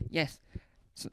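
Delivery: normal talking voice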